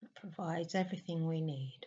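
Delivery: soft meditation voice with long pauses; hushed, spacious meditation guidance; guided meditation. Real, not acted. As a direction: guide a listener gently into stillness, leaving long silences.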